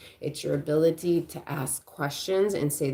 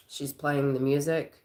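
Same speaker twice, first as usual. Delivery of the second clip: ordinary talk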